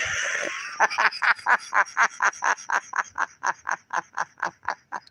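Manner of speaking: long, trailing fit of soft laughter; helpless, quiet real laugh petering out. Real, not acted. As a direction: laugh on and on, softly fading out. Amused at a chat message.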